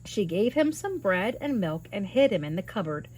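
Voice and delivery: plain reading voice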